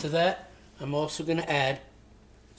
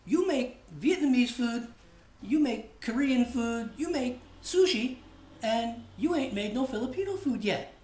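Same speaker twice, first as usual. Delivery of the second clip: higher, mock-scolding friend listing dishes; Pabs; 8 semitones higher than usual. Friend Pabs scolding the host over missing Filipino food, raised mock voice. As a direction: list the cuisines like a teasing buddy, voice raised. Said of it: "He goes".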